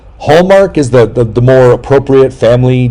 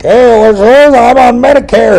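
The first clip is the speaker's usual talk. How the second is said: put-on loud, high, drawn-out elderly caricature; slow falsetto senior calling out; mock old person; 13 semitones higher, 5 dB louder, slower than usual.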